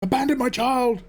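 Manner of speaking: very high, theatrical cry; hammed-up confession. Gas man in a boiler skit, hammed-up high wail. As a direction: wail it like an overacted film line.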